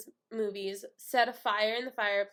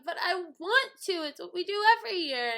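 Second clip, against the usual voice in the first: put-on high, loud childish protest; whiny arguing; 8 semitones higher, 5 dB louder than usual. Sister arguing at the bottom of the stairs, whiny protest. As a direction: plead and complain, quickly.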